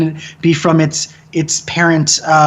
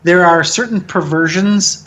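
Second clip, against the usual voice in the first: ordinary talk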